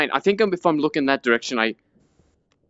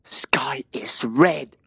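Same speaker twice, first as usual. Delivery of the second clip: whisper; 3 semitones lower, much slower than usual. Real, not acted. Eerie moment in horror game.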